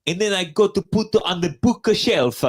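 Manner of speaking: imitated Sicilian woman's heavy Italian accent; thick Italian accent. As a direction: mimic a hostel keeper's strong Italian accent. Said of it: "that was her real accent".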